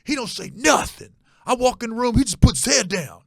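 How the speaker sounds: hammed-up stepdad griping; ranting stepdad. Stepdad complaining to mom about Gary, high mock rant. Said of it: "He's like".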